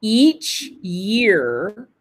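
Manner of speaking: word by word with long pauses; careful, drawn-out pronunciation demo in small chunks; slow model reading. Shown as a demonstration. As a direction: read slowly so learners can shadow. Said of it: "Could you please do it again?".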